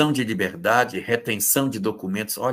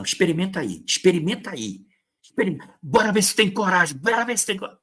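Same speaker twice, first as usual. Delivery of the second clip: mock threat; 8 semitones higher, faster than usual. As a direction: dare the partner to try it. Acting an intimidating partner.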